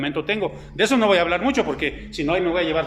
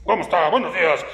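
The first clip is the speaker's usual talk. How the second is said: grumpy greeting; 3 semitones lower than usual. Retold or imitated. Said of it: "hay gente que siempre está enojada".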